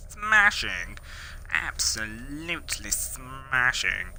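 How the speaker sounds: drawn-out, plummy cartoon impression; slow, exaggerated character catchphrase; Nigel Thornberry. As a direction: stretch it out in a hammy put-on voice. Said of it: "You go, Nigel Thornberry".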